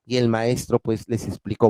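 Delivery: expressive classroom reading with voiced characters; storytelling to students; story read aloud. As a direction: read it to the class with feeling and intonation. Teacher reads story aloud to class.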